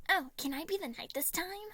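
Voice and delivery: put-on high, pleading child voice; hopeful. A narrator's reading. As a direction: ask it with hope. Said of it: "Remus asked hopefully".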